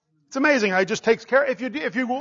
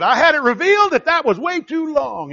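higher, louder punchline quote; retold pointed comeback; 6 semitones higher, 5 dB louder than usual. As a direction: imitate an emphatic retort. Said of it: "and he said".

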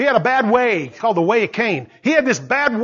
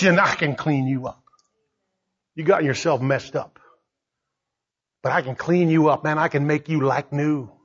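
tender God; 7 semitones lower than usual. Acted.

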